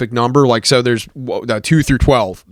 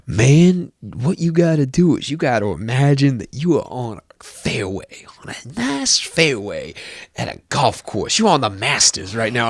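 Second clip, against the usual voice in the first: imitating the creepy guy's hushed coaching; mock hushed golf coach. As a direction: breathe it into his ear like a golf announcer. The greasy guy coaching in my ear.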